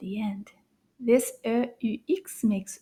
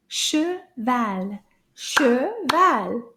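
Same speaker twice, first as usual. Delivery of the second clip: "Cheval" split into two distinct beats; syllable by syllable; much slower than usual. Shown as a demonstration. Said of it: "Let's clap the syllables together".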